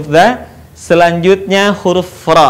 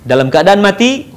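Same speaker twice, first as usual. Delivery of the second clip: ordinary delivery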